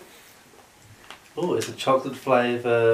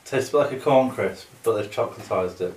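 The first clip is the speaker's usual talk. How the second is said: talking between bites; chewing while talking. Real, not acted. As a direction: mouth partly full. Seen 2: Both men nibble bar pieces, hands at mouths; speaker unsure.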